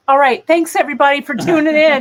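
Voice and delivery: high, loud announcer-style goodbye; hammed-up sign-off. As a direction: wrap it up like a TV host. Mock sign-off of cat cam.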